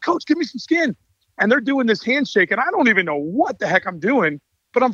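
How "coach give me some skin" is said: excited hype. Retold or imitated. Players asking for handshake.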